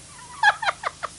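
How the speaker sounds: high squeaky giggle; high laugh. Real, not acted. Caught getting distracted, high squeaky giggle. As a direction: let a high giggle slip out.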